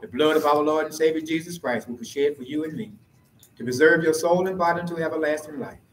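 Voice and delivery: normal talking voice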